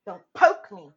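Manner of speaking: loud mock warning; stern warning. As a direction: snap it at him. Brother warning.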